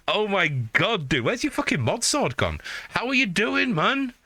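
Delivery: high, thrilled hello; overjoyed to see a friend; excited greeting. Real, not acted. Greeting long-missed friend.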